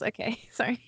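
usual speaking voice

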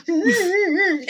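high, slow pulsing whine; straining hum. Acted. Rudolph trying to light his nose, high strained hum. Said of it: "then he just goes".

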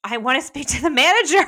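high, loud demanding customer; entitled customer voice. Pretend. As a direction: huff like an entitled shopper. Mock demand for the manager.

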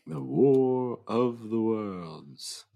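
slow, drawn-out movie trailer voice; dramatic announcer. Pretend. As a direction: announce the title slowly and grandly.

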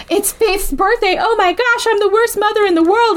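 high-pitched, hammed-up distress; mock panic. Retelling a mother forgetting a birthday, mock panicked. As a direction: imitate a mother horrified at herself.

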